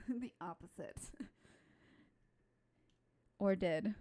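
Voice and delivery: ordinary delivery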